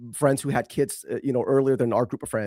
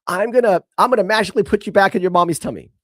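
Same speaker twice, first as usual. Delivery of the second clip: higher, louder and fast playful tease; playful teasing; 6 semitones higher, 6 dB louder, faster than usual. Retold or imitated. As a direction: tease the toddler like a mock magic threat. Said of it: "So I was messing with her".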